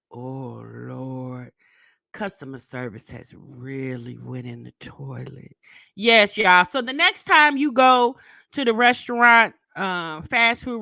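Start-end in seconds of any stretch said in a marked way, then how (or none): 0.1-5.5 low, very soft lament to oneself; resigned muttering. Retold or imitated. Customer to herself about customer service, low resigned mutter. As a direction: drop right down and mutter it, dismayed.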